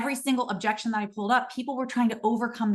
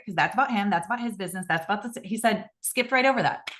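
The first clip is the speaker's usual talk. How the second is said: usual speaking voice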